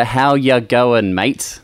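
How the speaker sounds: "How ya going, mate?" drawn out slowly; slowed-down demo. Shown as a demonstration. Coach repeating for a confused learner, slow and drawn out. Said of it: "H-, hey what?".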